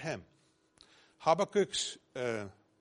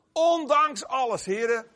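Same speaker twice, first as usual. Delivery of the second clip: loud proclamation; 10 semitones higher, 10 dB louder than usual. Real, not acted. Preacher's loud climactic declaration.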